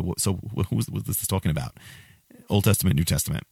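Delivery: ordinary talk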